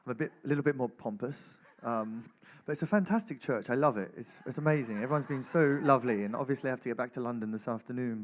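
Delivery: pompous English accent; English accent, a little bit pompous. Shown as a demonstration. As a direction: put on a pompous English accent, placed at the front of the mouth. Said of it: "your best English accent".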